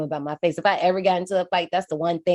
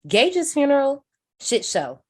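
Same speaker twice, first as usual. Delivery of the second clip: ordinary talk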